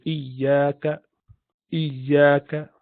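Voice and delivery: iyaka with a doubled ya; modeling ya; ya demo. Shown as a demonstration. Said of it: "And ya likewise".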